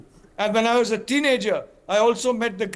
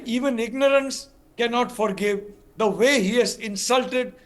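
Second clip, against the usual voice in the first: ordinary delivery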